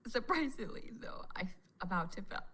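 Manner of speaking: unvoiced, confiding whisper. Real, not acted. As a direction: whisper the secret to the viewer. Confiding embarrassing detail.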